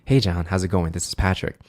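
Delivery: low, calm radio greeting; late-night radio hello; cool voice. Shown as a demonstration. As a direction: keep it low to make them feel calm. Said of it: "The cool voice is".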